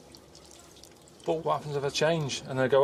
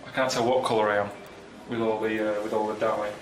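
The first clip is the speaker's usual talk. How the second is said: feeling sick; 3 semitones lower, 4 dB quieter than usual. Real, not acted. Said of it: "I feel really sick".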